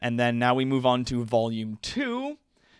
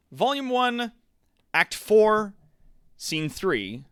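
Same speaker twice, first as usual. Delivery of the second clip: grand theatrical heading; dramatic announcement; 11 semitones higher, 4 dB louder, slower than usual. Acted. Streamer opening the dramatic reading, grandly announced. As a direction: proclaim it like a stage herald.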